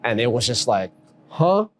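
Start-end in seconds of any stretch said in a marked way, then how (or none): 1.3-1.7 short, high, rising huh; baffled reaction. Retold or imitated. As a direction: retell a moment of stunned confusion. Acts out his stunned reaction.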